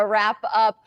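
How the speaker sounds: usual speaking voice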